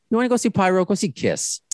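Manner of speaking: high-pitched, dismissive comeback; exasperated retort. Retold or imitated. Fan answering complaints about the stage, exasperated.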